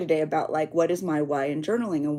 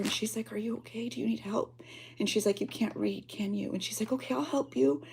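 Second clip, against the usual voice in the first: soft retold kindness; 3 semitones higher, 9 dB quieter than usual. Retelling the kind girl softly.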